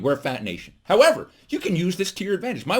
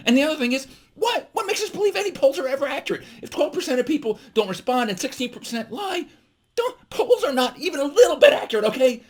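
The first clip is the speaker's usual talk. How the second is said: high-pitched, mounting exasperated rant; worked up; 9 semitones higher than usual. Real, not acted. Comedian ranting that polls can't be trusted, worked up. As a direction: get carried away, voice climbing high. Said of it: "I'm getting excited".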